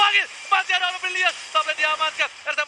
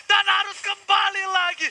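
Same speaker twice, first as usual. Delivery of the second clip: drawn-out hype shout; 6 semitones higher, 3 dB louder, slower than usual. Real, not acted. Casting a retreat.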